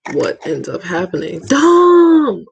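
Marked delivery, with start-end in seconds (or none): 1.5-2.5 excited greeting. Real, not acted. Greeting a friend in chat.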